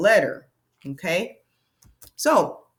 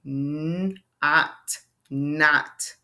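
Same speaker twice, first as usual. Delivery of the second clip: very slow, letter by letter; teacher sounding out a word; 3 semitones lower than usual. Shown as a demonstration. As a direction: stretch out each sound. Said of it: "spelling out a word".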